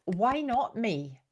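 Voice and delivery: ordinary delivery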